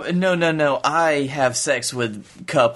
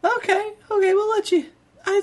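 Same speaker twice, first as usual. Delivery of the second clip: squeaky high voice; high falsetto. Acted. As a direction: jump way up into falsetto. Falsetto reaction.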